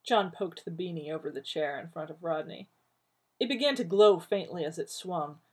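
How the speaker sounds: usual speaking voice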